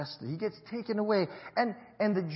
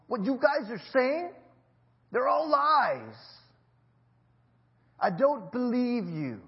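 loud, higher, drawn-out rebuke; acted confrontation; 5 semitones higher, 6 dB louder than usual. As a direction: throw it forcefully at the accusers, slowing down. Voices Pilate confronting the accusers.

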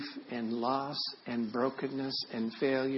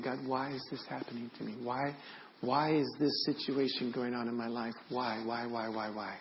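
very soft, weary questioning; hushed lament; 7 dB quieter than usual. Retold or imitated. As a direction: murmur the whys as a quiet plea. Voices listeners' grieving questions to God.

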